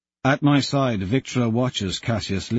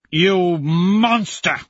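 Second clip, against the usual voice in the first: slow, high, loud cry of outrage; outraged cry; 8 semitones higher, 5 dB louder, much slower than usual. Acted. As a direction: hurl it at your son. Father outraged at son.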